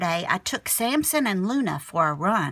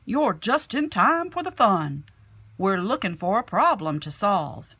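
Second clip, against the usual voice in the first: louder, bright-voiced teacher greeting the class; cheerful teacher; 5 dB louder than usual. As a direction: sell the fun to the class.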